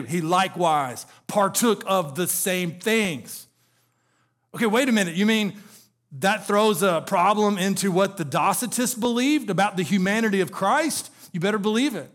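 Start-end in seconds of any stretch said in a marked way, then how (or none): none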